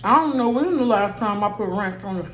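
chewing while talking; talking between bites; mouth full. Real, not acted. Seen 1: Bite taken at -3; cheeks full, chewing motions while talking.

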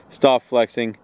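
normal talking voice

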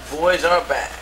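ordinary talk

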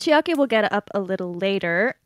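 usual speaking voice

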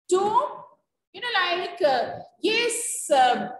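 ordinary delivery

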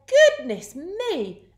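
high, loud, drawn-out exclamation; startled farmer. A narrator's reading. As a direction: exclaim as a farmer jolted awake. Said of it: "The farmer woke and said".

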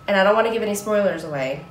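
ordinary delivery

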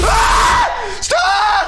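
high panicked scream. Real, not acted. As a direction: shriek stop in fright. Scared screaming at jumpscare.

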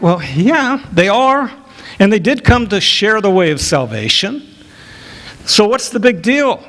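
high, casual so-what voice; a listener shrugging at the fuss; shrugging question. Pretend. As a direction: wave it off, why the fuss.

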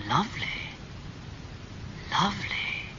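very soft, near-whispered admiration. A narrator's reading. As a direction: breathe it out, quietly enchanted. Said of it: "he said softly".

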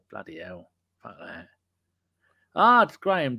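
ordinary talk, nothing marked

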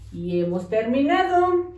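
usual speaking voice

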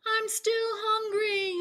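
high cheeky yell. Acted. Bullfrog yelling back at his chasers, high. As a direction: fling it back gleefully. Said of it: "yelled Bullfrog".

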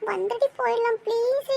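usual speaking voice

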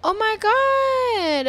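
gasp, then high, loud, drawn-out exclamation; amazed gasp. Real, not acted. Player discovering a cute hair option, gasping in amazement. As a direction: stretch it out in high-pitched amazement.

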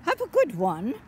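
loud, hearty call; called-out send-off. Real, not acted. Granny hailing someone in the park, calling out. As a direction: call it out loudly.

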